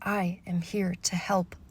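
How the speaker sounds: soft, hushed whisper; small whispering voice. Acted. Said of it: "with a small voice whispering".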